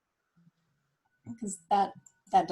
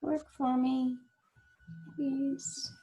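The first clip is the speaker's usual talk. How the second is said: pleading with computer; 5 semitones higher, 7 dB quieter, slower than usual. Real, not acted. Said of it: "I like to talk to my computer".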